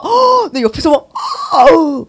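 high, loud exasperated gasps; driver's mock outburst. Imitating usually gentle people losing temper while driving, mock outburst. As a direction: imitate a driver exploding at the wheel. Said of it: "운전할 때 보면은 막".

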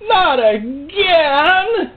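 high, loud, drawn-out wail of dismay; wailing devil. Acted. The devil sees its slave now armed, wailing high and loud. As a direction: cry out like a beaten devil.

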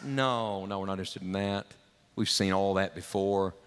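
a low, quick no-thanks; Tychicus answering. Acted.

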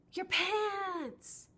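high, loud mocking yell; mocking shout. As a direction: yell it and point, hammed up. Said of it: "How is that attractive?".

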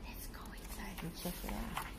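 put-on secretive whisper to the kids; soft spooky storyteller whisper. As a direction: hush them before the dark cave. Whispering before dark cave.

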